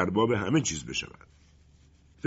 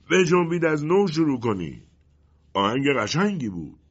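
raised, higher commanding call; loud voice; 6 semitones higher, 5 dB louder than usual. A narrator's reading. Colonel ordering the music to start over, loud voice. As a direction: call out an order across the clearing. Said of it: "سرهنگ با صدایی بلند گفت".

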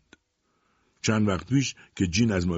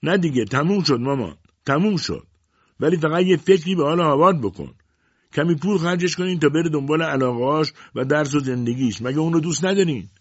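high, loud call across a distance; calling from afar; 9 semitones higher, 5 dB louder than usual. A narrator's reading. Daughter calling back to her mother from a distance, raised voice. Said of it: "جین از دور گفت".

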